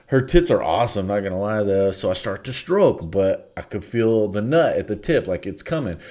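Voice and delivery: ordinary talk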